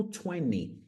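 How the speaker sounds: TWENTY said as "twenny"; American-style TWENTY without the T; twenty, T dropped. Shown as a demonstration. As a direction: cut the second T in TWENTY. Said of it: "Dá pra cortar aqui esse T".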